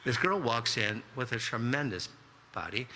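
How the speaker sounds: plain reading voice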